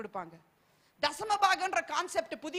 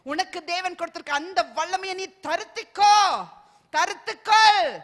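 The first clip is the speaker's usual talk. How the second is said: rising exhortation; 5 semitones higher, 11 dB louder than usual. Real, not acted. Preacher exhorting the church.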